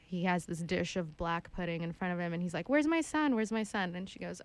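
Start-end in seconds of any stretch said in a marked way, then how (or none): 2.7-3.3 lightly voiced father, higher and louder; mock worried dad. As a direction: ask with hammed-up alarm. Father asks for his son.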